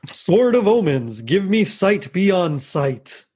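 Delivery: slow, drawn-out heroic incantation; Lion-O. Acted. Lion-O calling on his magic sword, slow commanding voice. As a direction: summon the sword's power, stretching each word. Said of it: "I can get his voice".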